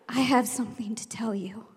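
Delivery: secretive whisper; hushed announcement. Retold or imitated. Teacher luring unruly kids into listening, secretive whisper. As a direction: drop to a mysterious whisper. Sudden hush to intrigue class.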